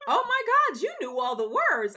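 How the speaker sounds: high, loud stunned cry; amazed exclaiming. Retold or imitated. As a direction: exclaim it like a shocked friend. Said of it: "my coworker said".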